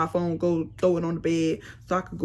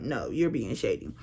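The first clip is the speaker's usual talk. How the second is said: cold and low; 8 semitones lower, 4 dB quieter than usual. Retold or imitated. She coldly tells her fiancé he's being shady, lower and softer. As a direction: lower and softer, flat rebuke.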